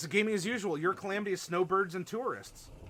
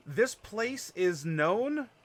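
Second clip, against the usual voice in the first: dramatic slow announcement; 5 semitones higher, 5 dB louder, much slower than usual. Acted. GM announces a place trait.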